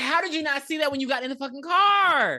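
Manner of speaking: exasperated shout. Retold or imitated. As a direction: yell at the screen in disbelief. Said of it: "I'm like".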